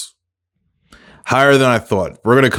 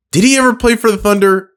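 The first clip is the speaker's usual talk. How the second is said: surprised question; 11 semitones higher than usual. Real, not acted. Sudden doubt mid-game.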